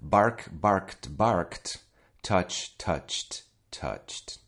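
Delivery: "barked" as barkt, "touched" as toucht; correct T endings. Shown as a demonstration. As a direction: recite the verb forms with crisp final T. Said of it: "that's the unwritten rule".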